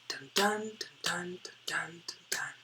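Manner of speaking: dun dun chanted like a heartbeat, fading to a whisper; heartbeat rhythm. Shown as a demonstration. Teacher voicing the poem's meter as a heartbeat. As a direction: tap out the beat with your voice. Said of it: "It's almost like a heartbeat".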